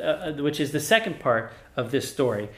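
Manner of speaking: usual speaking voice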